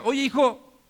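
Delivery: acted-out dad calling for his son; father calling out. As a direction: call out to your boy.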